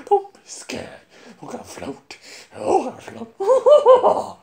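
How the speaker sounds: high, creepy clown voice with laughter; Pennywise. Acted. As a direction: taunt like a sinister sewer clown. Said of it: "next one is Pennywise".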